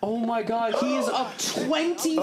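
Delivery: high, slow, laughing disbelief; stunned disbelief. Real, not acted. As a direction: gasp it out in shock. Reacting to a stalemate blunder.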